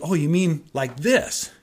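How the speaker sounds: imitated lightbulb moment; dawning realization. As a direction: act out a student suddenly catching on. Student's sudden understanding, acted.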